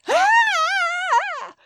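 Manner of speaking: high squealing mock scream of a kid; fake howl. Boy pretending to be spanked, high fake cry. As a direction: yelp as if the belt really landed. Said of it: "And the kid goes".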